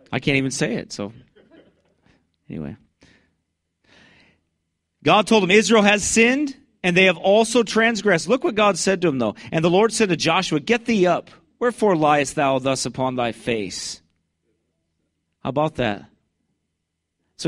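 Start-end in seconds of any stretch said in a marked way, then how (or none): 5.0-14.0 loud, high street preaching; full-force preaching. Acted. Street preacher thunders scripture at passersby, loud and high.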